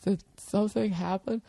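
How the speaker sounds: high, coy mock-innocent voice. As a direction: play it falsely innocent, up high. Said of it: "this look on your face like".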